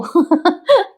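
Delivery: short high-pitched laugh; amused laugh. Real, not acted. Narrator amused that his luggage stayed behind, short laugh. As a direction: let out a quick laugh.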